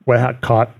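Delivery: usual speaking voice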